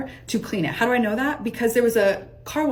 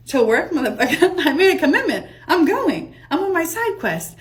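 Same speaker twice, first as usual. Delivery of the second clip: gleeful defiance; 7 semitones higher, 4 dB louder than usual. Retold or imitated. Gleefully defying friends, going to work.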